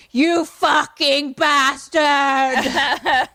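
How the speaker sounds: put-on loud, high, very drawn-out American accent yell; hammed-up, over-precise fake American accent at full volume; weird American accent. Carrie Larry yelling at the camera, weird American accent shout. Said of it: "That's exactly how he sounds".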